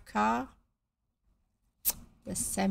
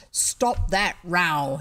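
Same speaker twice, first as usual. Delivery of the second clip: stern; 7 dB louder, much slower than usual. A narrator's reading. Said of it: "he said sternly".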